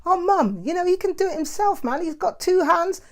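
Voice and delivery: high, fast, whiny protest; high-pitched eye-rolling reply to mum; exasperated reply to mum. Retold or imitated. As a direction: brush off your mum's nagging in a high pitch.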